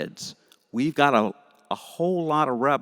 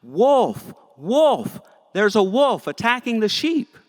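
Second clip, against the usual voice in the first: high, loud false alarm cry; bored shepherd boy hollering a fake warning, high and loud; yelling out; 11 semitones higher, 7 dB louder than usual. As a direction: shout wolf to fool the whole village. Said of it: "trick the villagers by yelling out".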